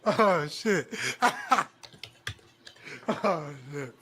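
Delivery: shouted oh shit with laughter; loud laughing exclamation. Real, not acted. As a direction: blurt it out, cracking up.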